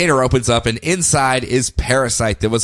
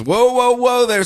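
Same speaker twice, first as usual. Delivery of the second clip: much higher, a hammed-up call to stop; high mock halt; 10 semitones higher, 3 dB louder than usual. [name] halts Superman with a high mock call. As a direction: [name] calling out to hold Superman back.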